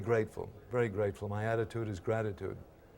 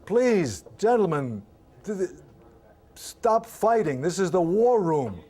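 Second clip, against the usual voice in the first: higher and louder, slow, begging the men to stop; pleading mock shout; 8 semitones higher, 9 dB louder than usual. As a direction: shout the plea to break up the fight. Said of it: "one of the guys says".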